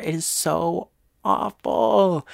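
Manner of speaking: drawn-out grieving groan; sad, slow. Real, not acted. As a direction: stretch it out, heartbroken. Grieving over character's death.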